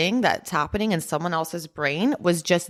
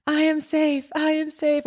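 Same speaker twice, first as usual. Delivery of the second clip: much higher, light and airy; a gentle hint of laughing through tears; relieved realization; 12 semitones higher than usual. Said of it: "almost, like, laughing and, like, crying".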